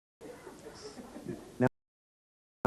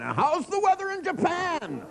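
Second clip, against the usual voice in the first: high, slow mock yell into a phone; shouting on phone; slower than usual. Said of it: "the guy kept screaming".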